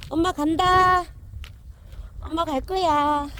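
high, loud sing-song call; calling the dogs. Real, not acted. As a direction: coax the dogs with a threat to leave. Telling the dogs she is leaving.

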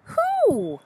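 high, falling questioning bear; bear asking who. A narrator's reading. Bear asks about a rustling sound, high and wondering. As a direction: ask it high and let it slide down.

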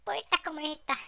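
toque agudo a lo Chiquito; imitando a Chiquito. Acted. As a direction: sube la voz imitando un poco al cómico. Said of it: "Tienes que hablar como chiquito". (imitación ligera de Chiquito, aguda)

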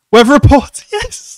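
name yelled high and loud, then a laughing yes; cheer, then laugh with joy; excited cheer. Real, not acted. Fan cheers another character appearing.

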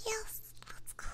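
usual speaking voice

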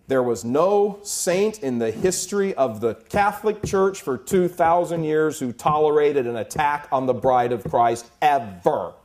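loud, high, driven preaching; full-force preaching. Real, not acted. Preacher to his congregation, thundering at full force. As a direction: hammer it home from the pulpit.

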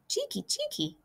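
very high, soft chirping scold; scolding swallow call. A narrator's reading. Swallows scold the visitor in a tiny high chirp. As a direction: squeak it out like a swooping swallow.

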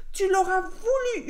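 loud storybook wolf yell; howled threat. A narrator's reading. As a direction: howl the threat at the pig. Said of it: "hurla le loup".

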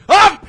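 short, very high, loud yelp; sudden shriek of fright; startled scream. Real, not acted. Player who was not ready for the scare, startled scream.

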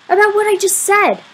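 high, exasperated retort. A narrator's reading. Boy answering a friend who plays dumb, exasperated. Friend pretends he did not hear.

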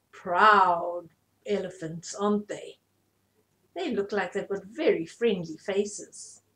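plain reading voice

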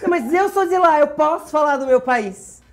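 hammed-up little protest, slowing at the end; mock pleading protest. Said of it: "tendo que pedir pra Nádia".

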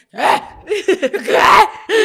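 loud, high double what; shocked disbelief. Retold or imitated. Alex hearing the lie, shocked and loud. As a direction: blurt it in shock. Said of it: "Y Alex".

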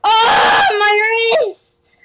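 loud, high pained cry. Real, not acted. Gamer whose wrist hurts from the mouse, loud pained cry.

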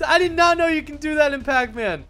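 high, excited play-by-play cry; amazed outburst. Real, not acted. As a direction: shout it, stunned by the move. Reacting to a surprise dodge.

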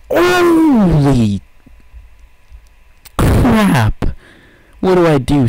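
high, loud scream of panic; panicked scream. Real, not acted. Gamer screaming as angry dogs attack. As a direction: scream as if cornered.

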